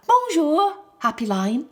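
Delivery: cheerful greeting. Acted. Boy greets the lion.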